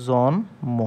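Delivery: জন্ম broken into জন and ম; syllable demonstration. Shown as a demonstration. Teacher writing out an example, saying জন্ম syllable by syllable. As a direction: sound out জন্ম in two separate beats. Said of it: "যেমন আমরা যদি বলি".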